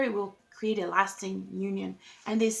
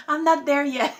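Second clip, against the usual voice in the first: laughing; 5 semitones higher, 8 dB louder than usual. Real, not acted. Joking about being naked outside.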